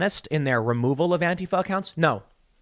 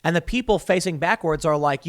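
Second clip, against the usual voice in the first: normal talking voice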